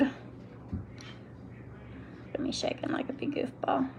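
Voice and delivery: soft whispered laugh at herself; whispered self-tease. Real, not acted. To viewers about her shaking hands, whispered. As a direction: whisper it, amused at your own shaky hands.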